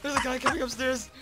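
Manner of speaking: normal talking voice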